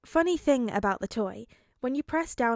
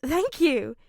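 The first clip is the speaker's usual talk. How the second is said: giggling; 5 semitones higher, 6 dB louder than usual. Acted. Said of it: "he giggles".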